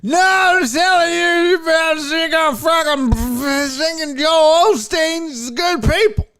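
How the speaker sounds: high, loud, dragged-out slurred holler; drunk heckler. Retold or imitated. Drunk heckler insists to the comedian, slurred high shouting. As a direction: wail it in a garbled drunken shout. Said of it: "He's drunk".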